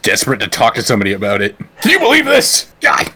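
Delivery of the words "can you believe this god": high, loud cry of disbelief; mock outraged yelling. Guy on the bus to anyone nearby, mock outraged yell. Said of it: "you're the guy on the bus".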